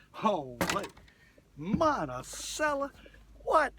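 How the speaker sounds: surprised exclamation. Real, not acted. Angler marvels at his catch on camera, slow loud exclamation. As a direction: stretch it out like you can't believe the fish.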